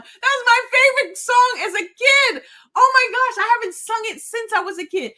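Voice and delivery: high, loud delight; excited. Real, not acted. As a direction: burst out with delighted recognition. Delighted at remembering favorite song.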